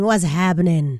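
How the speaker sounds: low-pitched echo. Real, not acted. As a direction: repeat it down low, falling at the end. Host repeats greeting in low voice.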